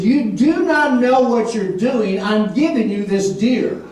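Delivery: slow, firm, word by word; emphatic divine rebuke. Retold or imitated. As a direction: spell it out slowly, as to a stubborn pupil. God's emphatic rebuke acted out.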